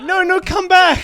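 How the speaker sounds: pleading mock voice. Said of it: "you were hoping they were like".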